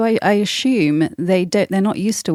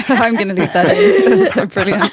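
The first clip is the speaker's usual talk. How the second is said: laughing; 3 semitones higher than usual. Real, not acted. Host laughing at forgotten thought.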